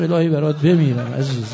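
low, tearful endearment; low voice heavy with grief; grieving lament. Real, not acted. Lamenting for the orphaned child.

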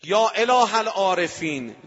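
loud, high, drawn-out invocation; calling out to God. Retold or imitated. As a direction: cry out slowly to heaven.

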